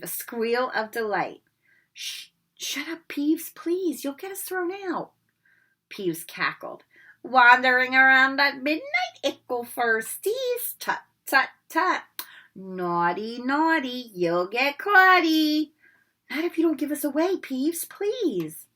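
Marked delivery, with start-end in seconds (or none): 1.9-5.1 whispered high pleading; frantic hushed plea; urgent whisper. Acted. Pleading with Peeves. 7.2-15.7 high, loud sing-song mockery; cheeky spirit teasing; taunting poltergeist. Peeves taunting. 16.3-18.7 fast, high pleading; anxious child pleading; hurried plea. Acted. Begging Peeves.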